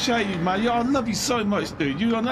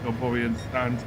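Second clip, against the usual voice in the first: normal talking voice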